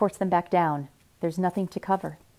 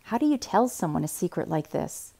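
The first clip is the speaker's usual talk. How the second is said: usual speaking voice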